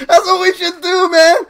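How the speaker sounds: high words squeezed through laughter; laughing. Real, not acted. Streamer proposing fake accounts, laughing. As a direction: say it while still cracking up. Still laughing at own idea.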